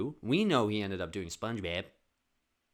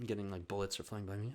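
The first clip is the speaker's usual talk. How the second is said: usual speaking voice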